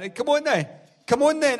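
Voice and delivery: put-on high, loud drunken dare; rising, yelled come on then; taunting shout. Drunk man daring two guys in a graveyard, high taunting shout.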